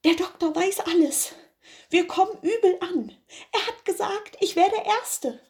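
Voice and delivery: a scared servant blurting it out to his mates; frightened servant. Acted. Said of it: "ward ihm Angst, und er sagte draußen". (der Diener erzählt den Kameraden ängstlich, deutlich höher)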